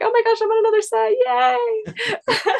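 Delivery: gleeful high-pitched cheer, acted out; uber excited. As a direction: re-enact being thrilled beyond belief. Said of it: "uber excited".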